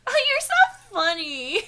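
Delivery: high, drawn-out giggly gushing; gushing flattery. Acted. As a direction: laugh it out as the smitten woman. Woman flattering the visitor.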